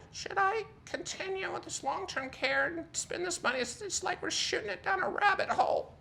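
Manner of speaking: very high, soft put-on mom voice; exaggerated falsetto impression of his mother; imitating mom. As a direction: squeak it out as a mock worried mother. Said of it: "Her voice is better than that, but that's a pretty good voice".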